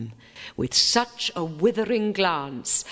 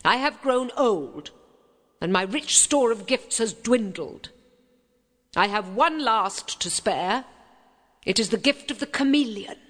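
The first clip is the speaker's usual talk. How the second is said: put-on old fairy; 6 semitones higher than usual. A narrator's reading. Old fairy speaks her gift.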